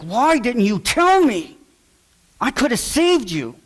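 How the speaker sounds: put-on high, slow, pleading cry to his children; anguished plea. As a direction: beg them, voice lifted high with regret. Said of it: "grab my children and be like".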